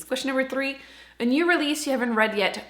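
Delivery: ordinary talk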